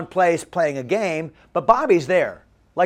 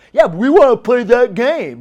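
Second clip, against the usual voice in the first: louder, higher eager plea; eager teens; 6 semitones higher, 8 dB louder than usual. Acted. Teenagers to their youth pastor, eager pleading. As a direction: voice keen teenagers insisting.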